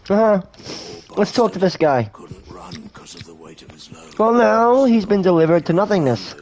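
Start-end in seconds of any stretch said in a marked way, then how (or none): none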